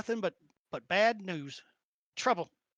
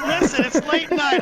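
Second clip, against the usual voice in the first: laughing; 7 semitones higher, 10 dB louder than usual. Real, not acted. Defending late night game.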